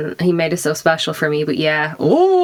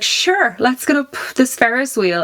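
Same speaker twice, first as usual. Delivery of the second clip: breezy bravado; 7 semitones higher than usual. Retold or imitated. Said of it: "And I was like".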